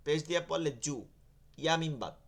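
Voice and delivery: ordinary delivery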